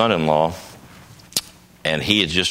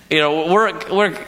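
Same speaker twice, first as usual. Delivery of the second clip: laughing; 10 semitones higher, 4 dB louder than usual. Real, not acted. Laughing at the story.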